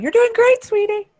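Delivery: very high, loud mock cheer; shrill-pitched encouragement called from the sidelines; background cheerleader. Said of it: "We're gonna be in the background".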